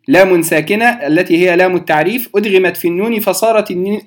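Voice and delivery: normal talking voice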